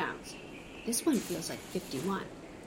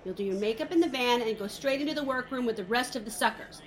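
ordinary delivery

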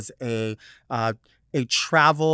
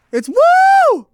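high, loud whoop on woo; woo shout; 11 dB louder than usual. Acted. Host whooping her catchphrase, high and loud. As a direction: shriek the woo like a party girl.

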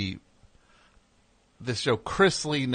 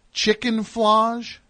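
high, drawn-out disbelieving question; incredulous, pitched way up and slow; 10 semitones higher, 3 dB louder, much slower than usual. Real, not acted. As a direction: stretch it out in disbelief. Scoffing at a silly product name.

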